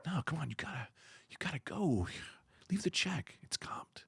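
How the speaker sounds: soft, hushed seductive bouncer; a hint of sultry whisper; sexy voice. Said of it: "You're doing a sexy voice".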